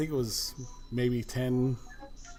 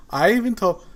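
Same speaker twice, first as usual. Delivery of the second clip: loud, higher, irritated British lady; angry retort; 6 semitones higher, 8 dB louder, faster than usual. Retold or imitated. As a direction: snap back at the caller, raised and sharp. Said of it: "very angrily".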